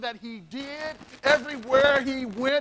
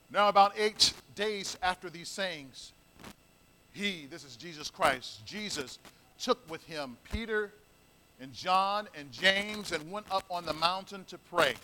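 softer, lower reading of the verses; scripture reading; 6 semitones lower, 6 dB quieter than usual. Acted. Preacher reading Luke to the congregation, softly. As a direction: read the passage in a hushed tone.

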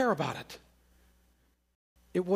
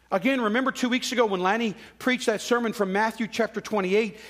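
ordinary delivery

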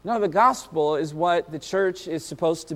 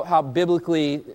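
ordinary talk

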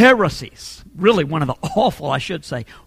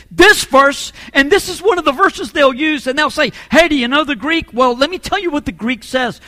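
higher, driving sermon delivery; emphatic preaching; 5 semitones higher, 4 dB louder than usual. Real, not acted. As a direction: argue the point hard from the pulpit.

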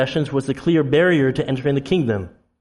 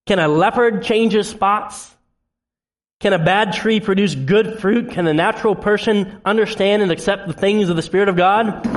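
ordinary talk, nothing marked

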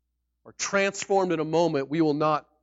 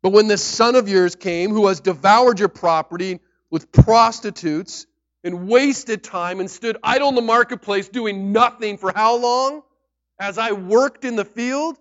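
louder, higher, aggrieved protest; older son protesting to his father, loud resentment; resentful complaint; 6 semitones higher, 11 dB louder than usual. Retold or imitated. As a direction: read it as the wronged older son, building up. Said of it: "he answered his father".

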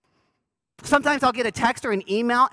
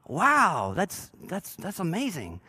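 fake interest; 3 semitones lower, 4 dB quieter, slower than usual. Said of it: "You don't express like that normally".

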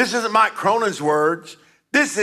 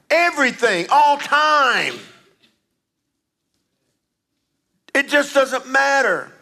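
preaching; 8 semitones higher than usual. Real, not acted. Preaching at the congregation.

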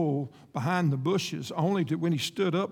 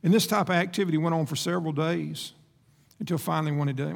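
usual speaking voice

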